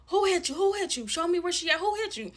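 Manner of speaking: loud, high, urgent demand; imitated angry mother. Mom asking her daughter who hit her, loud mock outrage.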